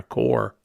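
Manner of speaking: core said as kore, with the r fully said; core sound. Shown as a demonstration. Vlogger imitating how friends from New York start coffee. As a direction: say core with a strong r. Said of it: "or C O A R".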